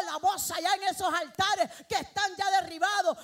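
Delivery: loud, high, driving sermon cry; preaching at full force. Acted. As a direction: lift the whole church with a shout. Predicación a todo volumen. (predicación a gritos, con fuerza plena)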